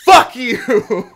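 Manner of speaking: loud, high screamed curse; furious yelled curse at a coworker; retold scream. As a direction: scream it in her face. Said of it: "see her scream".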